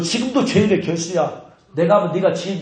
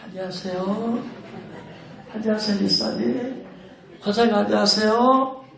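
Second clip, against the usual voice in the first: polite greetings. Shown as a demonstration. Pastor demonstrates greeting church elders sweetly. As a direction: greet each elder kindly. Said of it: "사람들 보면 뭐라고?".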